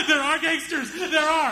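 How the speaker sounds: high-pitched frightened mock wail; panicked cry. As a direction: blurt it out in breathless panic. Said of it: "Hamza is hyperventilating".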